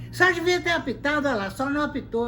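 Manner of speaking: high, loud indignant complaint; angry protest. Retold or imitated. Player runs up protesting to the referee, mock angry yell.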